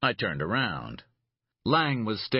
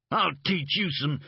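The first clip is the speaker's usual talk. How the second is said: taunting threat; 9 semitones higher than usual. Acted. Narrator threatens before kick.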